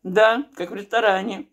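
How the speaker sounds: words around mouthful of food; chewing between words; talking while chewing. Real, not acted. Seen 2: Took a bite at -9; jaw keeps chewing while speaking.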